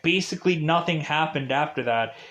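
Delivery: ordinary talk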